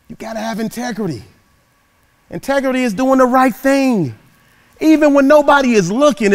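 high, loud, rousing preacher's call; preaching. Acted. Motivational speaker rousing the audience, preaching loud. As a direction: preach it to the whole crowd, big and lifted.

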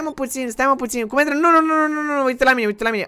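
high, loud pleading nos; frantic high pleading; alarmed shout. Acted. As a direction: shout him back from the edge. Friend about to jump.

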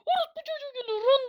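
high panicked screaming voice; being chased. Retold or imitated. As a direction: shriek it while laughing in panic.

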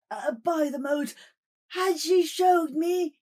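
high, shouting, breaking account; terrified. Acted. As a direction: blurt out the horror. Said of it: "the terrified man".

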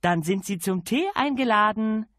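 lady calling out a merry invitation; cheerful call. A narrator's reading. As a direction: sing it out happily. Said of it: "rief sie fröhlich".

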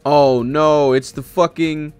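ordinary talk

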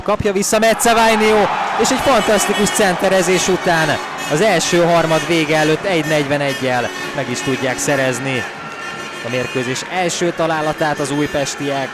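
loud, high rising goal call; excited hungarian sports commentary. Real, not acted. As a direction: shout the goal as it happens. Goal scored in live hockey.

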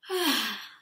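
a long, loud demonstration sigh; deep sigh. Shown as a demonstration. Teacher showing students a deep sigh, loud and long. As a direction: let a big deep sigh out for the class. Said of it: "Can you do that with me?".

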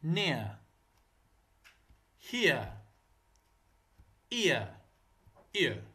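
English example words "نيا، هي، إيا، إير" ending in a gliding "ee-uh", no R; silent final R. Shown as a demonstration. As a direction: say each word alone with falling pitch, R left silent in "إير". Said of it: "الراء ما يلفظ".

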